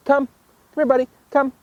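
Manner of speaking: high-pitched coaxing dog call; high recall command with gaps between. Shown as a demonstration. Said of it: "let's say I'm asking him to come".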